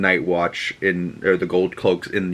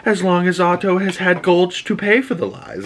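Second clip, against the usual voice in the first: mocking ex; 9 semitones higher than usual. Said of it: "she goes".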